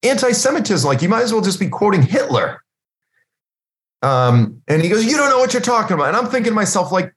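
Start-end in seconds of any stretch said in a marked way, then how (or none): 0.0-2.6 unvoiced disbelief. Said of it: "express it to him like that". 5.0-6.1 high, rushed mock protest; quick indignant comeback, put on; upset retort. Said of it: "he's getting upset".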